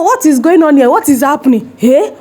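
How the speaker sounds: ordinary talk, nothing marked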